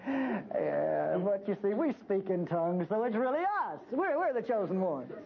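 smug Pentecostal. Retold or imitated. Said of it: "snickering and saying".

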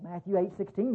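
usual speaking voice

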